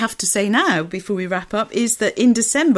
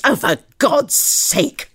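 slow, higher, exasperated snarl; 4 semitones higher, 5 dB louder, slower than usual. Acted. Dyson snarling at the car turning ahead, slow angry snarl. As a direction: spit the words out at the stalled traffic. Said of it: "snarled Dyson".

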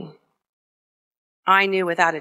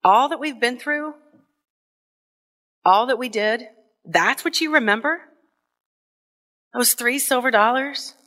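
higher, tearful, wounded questioning; hurt disbelief; 5 semitones higher than usual. Retold or imitated. Mother hurt her child remembers only this, tearful and higher. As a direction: ask it as if the memory stings. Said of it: "glistened with tears".

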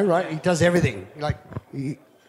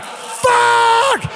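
shouting; 5 dB louder than usual. Retold or imitated. Retold reaction to swell news.

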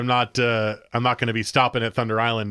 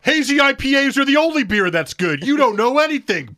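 much higher and louder, hammed-up arrogance; mock snobby rant; 13 semitones higher, 6 dB louder than usual. Mocking a beer snob talking to fans, a loud hammed-up rant. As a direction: sneer it like a loud know-it-all.

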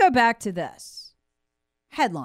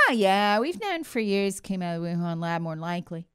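mock offhand; 4 semitones lower than usual. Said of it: "Wray come out and say".

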